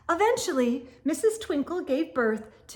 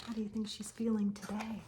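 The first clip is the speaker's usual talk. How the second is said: fast, secretive whisper; whispered aside; 2 semitones lower, 10 dB quieter, faster than usual. Real, not acted. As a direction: lean in and whisper it. Aside question to young listeners.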